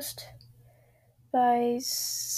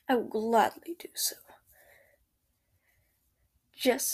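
ASMR whisper. Acted. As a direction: whisper gently, close to the ear. Whispered comforting boyfriend roleplay.

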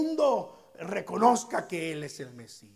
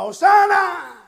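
loud, high ringing shout; slow jubilant acclamation; crowd shout; 11 semitones higher, 15 dB louder, slower than usual. Acted. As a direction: shout Hosana like a cheering crowd. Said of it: "Entonces el pueblo gritaba".

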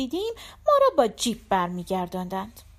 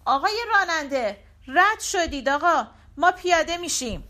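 calling out to the driver, higher and urgent; shouting; 6 semitones higher than usual. Retold or imitated. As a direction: raise your voice, he missed our stop. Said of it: "داد زدم". (با صدای بلند و فوری داد زدن)

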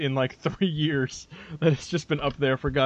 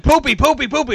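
high, loud taunting poopy chant; hyper kid; 10 semitones higher, 8 dB louder than usual. Retold or imitated. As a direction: mimic a kid taunting, much higher and louder. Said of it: "he's like".

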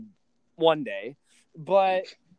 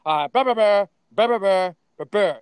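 louder mock nagging blah blah; mock nagging mom; 5 semitones higher, 7 dB louder than usual. Son mocking his mom's complaint, louder blah blah. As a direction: parody her complaint. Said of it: "She's like".

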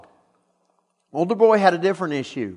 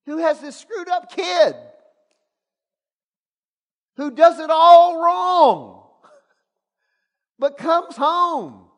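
higher, slowing, breaking into laughs and a shout; building excitement; 11 semitones higher than usual. Real, not acted. Preacher marvelling at the loving father, laughing and shouting. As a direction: let the joy overflow.

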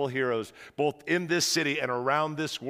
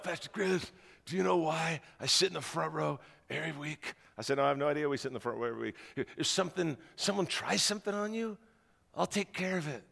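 soft, low mobster voice; quiet menacing bodyguard; Glenn's low voice; 6 dB quieter than usual. Retold or imitated. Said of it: "He comes up to me".

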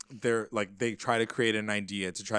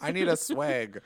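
higher, louder spoiled demand; mock whine; 5 semitones higher, 5 dB louder than usual. Mocking Veronica begging for her flask back, hammed-up whine. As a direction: whine like a brat for her drink.

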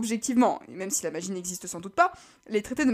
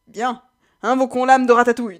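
voix de personnage; 3 semitones higher, 12 dB louder than usual. Acted. Liam lance l'invocation.

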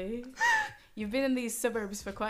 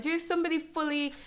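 normal talking voice

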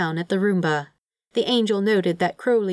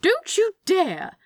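very high, drawn-out objection; alarmed protest; 13 semitones higher, slower than usual. Acted. Demon hiding from the Roomba, alarmed protest. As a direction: forbid it in a panic.

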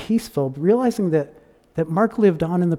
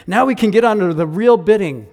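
eager; 5 semitones higher, 6 dB louder than usual. Acted. Eager bidders talking.

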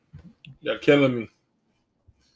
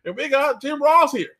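higher-pitched mock broadcast hype; live show host; 6 semitones higher than usual. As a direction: hype a sold-out show on air. Pretend live broadcast from sold-out show.

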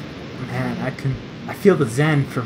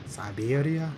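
softer fake Russian accent; mock Russian accent; 9 dB quieter, slower than usual. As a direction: echo it quietly in a Russian accent. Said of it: "Russian accent".

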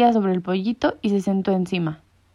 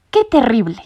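exclaiming; 3 semitones higher, 6 dB louder than usual. A narrator's reading. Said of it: "exclamó Sabelotodo".

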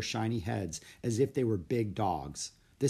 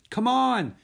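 high, loud urging; excited girl crying out; eager cry; 13 semitones higher, 8 dB louder than usual. A narrator's reading. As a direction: call a friend to join in. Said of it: "cried Annie".

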